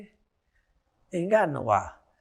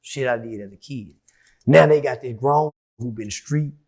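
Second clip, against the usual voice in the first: normal talking voice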